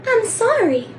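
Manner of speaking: mock actress voice. Playing the actress, high-voiced apology. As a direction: pitch up high as the blonde actress. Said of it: "I will now reenact for you".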